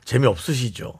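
low, soft and understated; casual low question. Shown as a demonstration. Male coworker to new colleague at company dinner, low and soft. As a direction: say it low and gently. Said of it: "이거 같은데".

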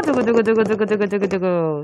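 soft spoken drumroll. Pretend. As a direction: mimic a drumroll softly. Drumroll before the answer.

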